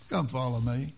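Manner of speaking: normal talking voice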